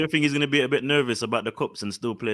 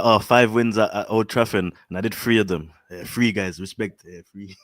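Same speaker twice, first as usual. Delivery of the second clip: imitating the manager; 6 semitones lower, slower than usual. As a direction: mimic the manager boasting, pitched down. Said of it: "He's gonna be like".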